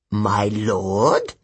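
demon addressing its master; reverent demon. Acted. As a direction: offer it up like a kneeling creature. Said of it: "one said".

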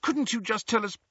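high-pitched earnest student, imploring; high, coaxing appeal; pleading girl. A narrator's reading. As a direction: beg politely for an answer. Hermione pleads with Phineas Nigellus.

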